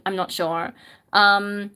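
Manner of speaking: ordinary talk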